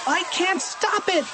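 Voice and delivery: very high, frantic schoolgirl wail; acted high-pitched alarm; panicked cry. A narrator's reading. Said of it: "she cried".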